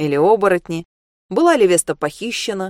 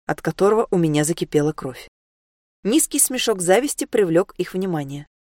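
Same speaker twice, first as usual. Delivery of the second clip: ordinary delivery, nothing marked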